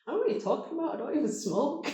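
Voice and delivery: high-pitched, innocent protest; mock innocent denial. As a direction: play dumb in a high squeaky voice. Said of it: "And I'm like".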